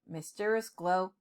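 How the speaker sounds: ordinary delivery, nothing marked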